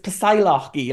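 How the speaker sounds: "Psylocke" read as spelt, "puh-sigh-LOCK-ee"; mispronounced Psylocke. Shown as a demonstration. Retelling a childhood misreading, "Psylocke" mispronounced as spelt. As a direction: sound out "Psylocke" slowly with an "ee" ending. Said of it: "I didn't know how you pronounced it".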